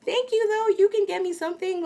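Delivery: very high put-on voice; woman's imitated voice. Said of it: "And she's like".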